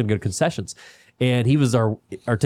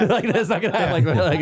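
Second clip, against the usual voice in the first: laughing; 7 semitones higher, faster than usual. Real, not acted. Laughing at the medic.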